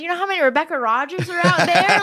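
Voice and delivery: high, loud and laughing through it; playing along cheerfully to waste his time; mock helpful enthusiasm. Rebecca teases the scammer about other Rebecca Rogers, laughing, high and loud.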